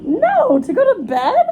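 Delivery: high, loud disbelieving question; incredulous. Real, not acted. Answering an offer of shoes at bedtime, incredulous. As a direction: shoot it back high, as if absurd.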